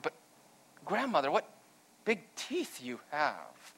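a hint of a frightened girl, slowing and fading on the last words; slightly quivering. A narrator's reading. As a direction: let the voice wobble a little as fear creeps in. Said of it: "Her voice quivered slightly".

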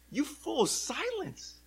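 high, mock-stern rebuke; stern hushing. Crowd silencing the beggar who cries out, stern hushing. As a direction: tell him off sternly in a high voice. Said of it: "who were sternly telling him to be quiet".

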